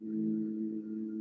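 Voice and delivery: a long, steady, low hum held on one note; low hummed tone. Shown as a demonstration. Psychic showing Amanda the tone for her son: low steady hum. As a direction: hum the deep tone for her to copy. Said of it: "deep tone".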